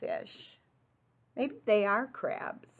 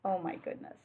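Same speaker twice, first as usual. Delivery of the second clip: usual speaking voice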